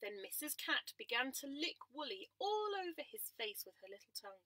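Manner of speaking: gentle storytime narration; reading to children. A narrator's reading.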